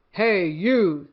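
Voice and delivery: loud, bossy challenge; picking a fight with a gorilla, loud and grouchy; grouchy ladybug. Acted. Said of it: "said the grouchy ladybug".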